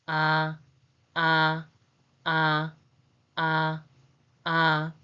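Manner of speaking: "Ah" held long on a level pitch; separate, drawn-out "ah" for learners to copy; vowel demo. Shown as a demonstration. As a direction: say "ah" as a steady open vowel, evenly repeated. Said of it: "The pronunciation is".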